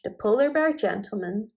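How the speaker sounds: usual speaking voice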